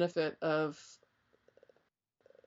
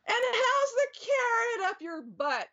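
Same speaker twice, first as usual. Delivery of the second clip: high, slow teasing squeak; hammed-up needling, pitched way up; mock jab; slower than usual. Carlos ribbing the seers back, high mock-teasing. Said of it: "And he'd be like".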